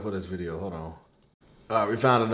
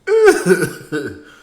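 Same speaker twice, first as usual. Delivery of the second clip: loud laugh; 6 semitones higher, 11 dB louder than usual. Real, not acted.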